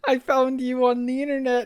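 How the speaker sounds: high-pitched, drawn-out student voice; gushing student. Acted. Student finds teacher streaming online, high mocking gush. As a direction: squeal it like a starstruck kid. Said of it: "A student would be like".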